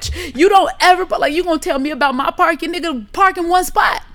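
high, loud acted-out telling-off; irritated rant. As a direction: let loose at the bad parker in mock confrontation. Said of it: "I was so irritated".

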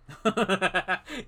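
real burst of laughter; amused laugh. Real, not acted. Laughs at co-host's crude joke.

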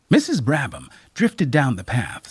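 usual speaking voice

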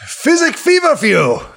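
put-on high, loud, delighted old man; overjoyed to meet an old friend; excited old healer.

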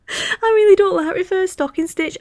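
high, loud mock cry; mock wail. Real, not acted. Podcaster admitting her pet hate, mock crying. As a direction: wail it in playful despair.

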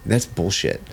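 slow, controlled retold anger; pissed off. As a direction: condemn it with restrained disgust. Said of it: "so I was pissed".

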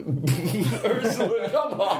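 high, slow, laughing appeal to the dog; laughing plea. Real, not acted. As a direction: laugh and beg the dog to stop. Dog interrupts with snoring.

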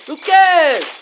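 calling out. Real, not acted. Calling after his friend.

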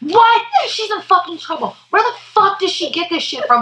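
high-pitched imitation of an incredulous brother; mock outrage. Host imitating her brother hearing the news, outraged. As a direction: act out a scandalized, squealing reaction.

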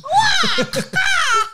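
high, loud shriek of laughter. Real, not acted. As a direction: burst into a screaming laugh. Shrieking laugh at accent joke.

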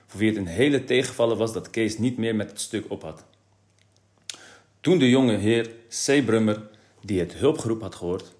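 usual speaking voice

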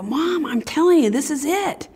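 put-on high-pitched, insistent child voice; excited kid. Eleven-year-old telling Mom about her calling, excited. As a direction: reenact a thrilled kid convincing Mom. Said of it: "excited I was. And I go".

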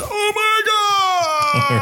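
shrieking at a high pitch; mock scream. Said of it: "go from precious to".

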